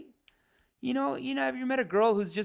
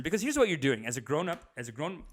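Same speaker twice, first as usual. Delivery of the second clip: ordinary talk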